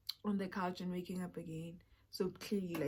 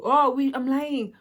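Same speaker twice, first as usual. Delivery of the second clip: loud outburst; 4 semitones higher, 13 dB louder than usual. Real, not acted. Telling friends, she loudly corrects herself. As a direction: blurt it out loud and slow.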